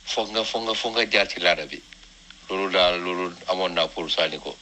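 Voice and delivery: normal talking voice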